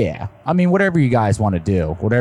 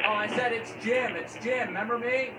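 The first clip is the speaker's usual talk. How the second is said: far from mic; 10 semitones higher, 13 dB quieter than usual. Real, not acted. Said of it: "You're just very faint".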